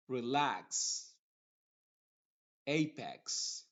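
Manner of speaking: box, fax and tax end in a crisp ks; final x said the English way as a clear ks. Shown as a demonstration. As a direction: demo of English words ending in x. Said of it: "Por ejemplo".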